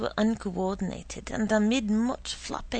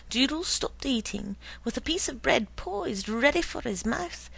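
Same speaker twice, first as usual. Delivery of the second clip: ordinary delivery, nothing marked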